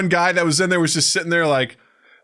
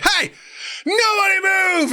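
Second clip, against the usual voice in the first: high, strained hold-up yell; mock hold-up shout; gunman's command. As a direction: play a gunman taking over a bus. Acts out gunman on bus.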